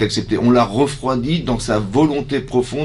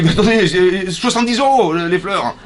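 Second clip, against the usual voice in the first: offended guest; 7 semitones higher than usual. Acted.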